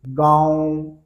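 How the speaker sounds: गाँव with a gentle nasal ँ, air through mouth and nose; soft anunasik demo. Shown as a demonstration. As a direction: ease गाँव out softly. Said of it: "तो आराम से निकलेगी". (गाँव को आराम से, हल्की नासिक ध्वनि में)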